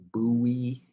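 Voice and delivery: "Bowie" tried out as BOO-ee. Shown as a demonstration. Trying out the other pronunciation, "Bowie" as BOO-ee. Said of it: "pronounced like Bowie".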